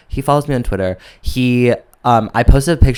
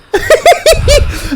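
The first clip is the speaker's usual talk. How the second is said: shrieking laugh; 5 dB louder than usual. Real, not acted. Big laugh.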